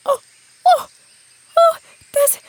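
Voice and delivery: high, stammering with joy; breathless little unicorn overjoyed; excited. Acted. Unicorn sees her parents.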